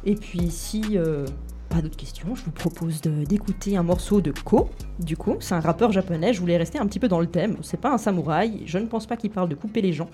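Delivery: ordinary talk, nothing marked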